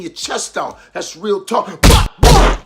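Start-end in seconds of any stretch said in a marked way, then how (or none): 1.8-2.6 high loud mocking bah bah; mock bah noise. Streamer closing his rant with a mocking bah noise. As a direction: blurt a silly bah bah to dismiss it.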